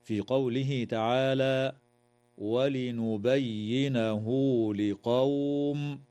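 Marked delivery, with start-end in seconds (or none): 2.4-6.0 "ولنبينه" slowly, its final haa stretched into a long oo; slow tajweed reading with the haa linked by a long vowel; recited with silah. Shown as a demonstration. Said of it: "وتوصل هاء الضمير بواو لفظية".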